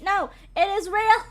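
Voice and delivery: high, loud comic protest; playful indignant outburst; mock-outraged insistence. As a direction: insist loudly in pretend offence. Mock insisting the hair is real.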